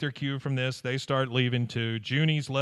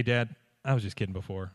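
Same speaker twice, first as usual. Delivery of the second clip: lower, softer sheepish admission; low sheepish kid; 6 semitones lower, 5 dB quieter than usual. Retold or imitated. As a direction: confess it quietly and sheepishly. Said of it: "he says".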